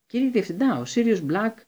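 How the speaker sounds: high loud urgent cry; urgent call. A narrator's reading. Harry calling urgently to Dumbledore, high and loud. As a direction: call out to the headmaster.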